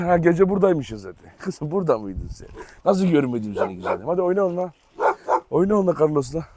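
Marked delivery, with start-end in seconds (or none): 1.4-4.7 tender cooing. Real, not acted. Cooing at found dog.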